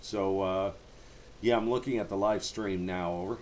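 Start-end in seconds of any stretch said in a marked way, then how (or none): none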